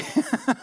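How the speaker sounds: a bright burst of laughter, pitched high; startled happy laugh; surprised laugh. Real, not acted. Surprised by friend's remark.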